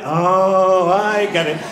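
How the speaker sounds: big, loud, drawn-out chorus of understanding; crowd's realisation. Acted. Crowd answers the man's warning, loudly catching on. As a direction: swell it like a whole crowd at once. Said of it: "so the crowd went".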